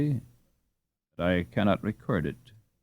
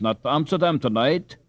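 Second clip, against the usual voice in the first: higher, louder fussing mother; scolding mother; 6 semitones higher, 5 dB louder than usual. Acted. Mother forbidding Hans to go tonight, fussing.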